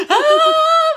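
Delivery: high-pitched happy squeal; overjoyed gasp. Retold or imitated. Voter learning the ballot counted, overjoyed gasp. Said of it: "I was so happy".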